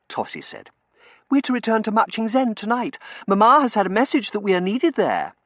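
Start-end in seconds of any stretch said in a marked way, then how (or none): 1.3-5.3 high, loud, breezy announcement; high-pitched, emphatic girl; girlish young lady. A narrator's reading. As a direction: announce the news like a pampered girl.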